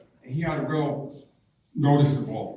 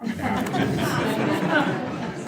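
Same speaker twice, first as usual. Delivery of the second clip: high-pitched giggle at his own joke; a bright, high burst of laughter; high amused laugh; 13 semitones higher than usual. Real, not acted. Jokes about getting carried away.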